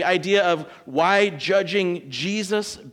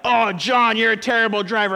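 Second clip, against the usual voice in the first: louder mock scolding of a bad driver; a touch of mock outrage at John; 4 semitones higher, 6 dB louder than usual. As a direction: chew out John for his driving, playfully. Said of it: "I'm not like".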